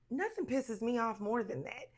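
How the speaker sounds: normal talking voice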